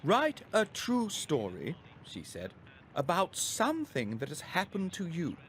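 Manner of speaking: teacher setting the class a story task; teacher's voice. A narrator's reading.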